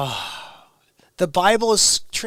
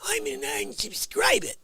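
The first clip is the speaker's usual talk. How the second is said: mock whiny commenter; 9 semitones higher, 8 dB quieter than usual.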